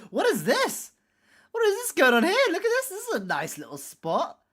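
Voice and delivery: high-pitched, swooping mock-curious voice; Gigi. Quoting a friend's reaction to a find, high mock curiosity. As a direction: do a high, playful impersonation. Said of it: "Gigi said".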